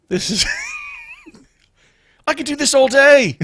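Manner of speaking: loud, high burst of glee between laughs; laughing outburst. Real, not acted. Host cracking up at his own accent bit, loud and gleeful. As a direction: shout it out, cracking yourself up.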